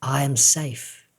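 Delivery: slow, soft and tender; said with love. Shown as a demonstration. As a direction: breathe the words out lovingly. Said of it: "Say it with love".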